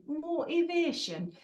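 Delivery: shout-along Geordie, vowels stretched out; Geordie accent. Shown as a demonstration. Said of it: "in a Geordie accent".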